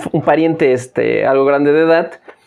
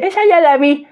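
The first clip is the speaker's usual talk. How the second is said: put-on much higher old lady voice, dismissive; grandma refusing; 12 semitones higher than usual. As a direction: brush it off like a stubborn granny.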